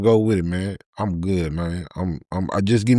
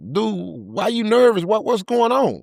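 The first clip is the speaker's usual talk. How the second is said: suspicious demand; 11 semitones higher than usual. Retold or imitated. Confronting nervous dude.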